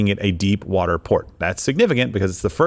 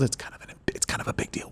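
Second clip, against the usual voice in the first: hushed conspiratorial whisper; 13 dB quieter, faster than usual. Acted. Host stressing the military base point in a stage whisper. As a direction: drop to a whisper for effect. Dramatic aside about military bases.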